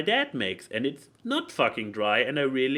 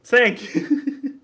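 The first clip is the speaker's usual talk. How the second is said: laughing thanks; 11 semitones higher, 6 dB louder than usual. Real, not acted. Co-host agrees with him.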